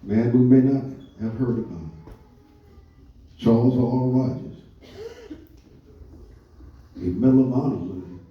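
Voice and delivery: usual speaking voice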